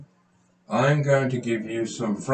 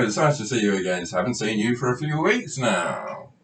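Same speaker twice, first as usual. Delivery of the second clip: ordinary talk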